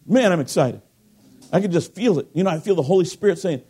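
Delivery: ordinary talk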